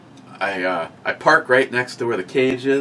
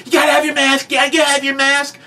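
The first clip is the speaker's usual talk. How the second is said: high mock yell, much higher; mock shout; 4 dB louder than usual. Mocking the Menards mask checker with a high mock shout. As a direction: bark it like a fussy store greeter. Mimicking a store mask enforcer.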